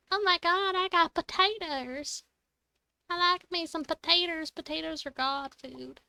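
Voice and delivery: high-pitched, hammed-up hillbilly twang; pretend hillbilly accent. Player playfully gushing over potatoes, in a put-on hillbilly accent. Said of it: "my pretend little accent I just threw on".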